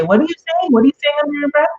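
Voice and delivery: high-pitched, indignant demand; mock-angry parent, pitch jumping way up. Parent challenging a child who mumbles under their breath, angry and high. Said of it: "and then you get angry".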